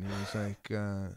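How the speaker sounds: ordinary delivery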